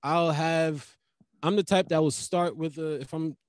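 ordinary talk, nothing marked

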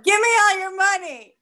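hammed-up stickup line; mock bank robber. Robbing the bank in a scene.

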